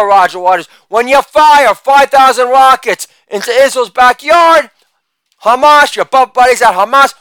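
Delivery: usual speaking voice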